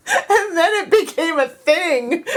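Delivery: ordinary delivery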